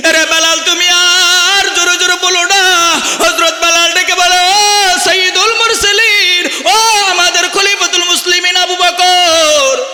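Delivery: high, loud impassioned sermon voice; preaching at full force. Acted. Preacher to his flock, high and loud. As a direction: cry it out over the crowd.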